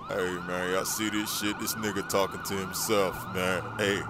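low, soft menacing voice; low threatening gangster; street thug. Acted. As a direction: brush off a lone challenger. Gangster taunting.

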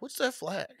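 lightly imitating an older guy's nosy question; teasing imitation of the stranger at the burger joint; mock creepy stranger.

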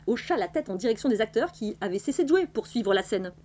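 ordinary delivery

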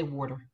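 water said as "worder"; Philadelphia pronunciation. Shown as a demonstration. Speaker admits the old accent stuck, Philly pronunciation. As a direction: keep the Philly aw-r in water, "wor-der". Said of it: "I still say water".

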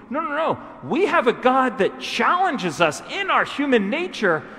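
loud, high sermon climax building in force; fiery preaching. Acted. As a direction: preach it out with full conviction to the congregation.

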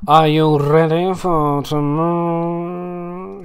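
slow, sweet motherly question; lightly voiced doting Narcissa. Narcissa asking Skylar about tomorrow, slow and sweet. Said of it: "she said".

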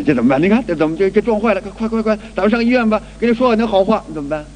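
ordinary talk

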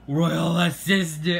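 loud, slow royal yell; drawn-out summoning shout. Acted. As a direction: bellow for the assistant. King yells for assistant.

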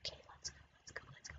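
sneaky whisper; whispered urging to move; spy whisper. Acted. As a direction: hush it like a spy slipping past.

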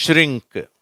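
ஷ்ரிங்க் said high and loud with shr merged; model word shrink. Shown as a demonstration. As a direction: stress ஷ்ரிங்க் clearly as a model. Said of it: "இதை நான் வாசிக்கிறேன்".

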